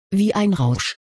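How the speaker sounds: plain reading voice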